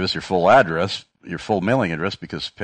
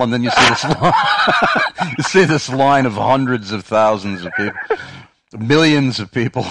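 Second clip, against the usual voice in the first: loud, high, laughing through the words; laughing while talking; 6 semitones higher, 7 dB louder than usual. Real, not acted. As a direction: crack up at your own joke. Laughing at own joke image.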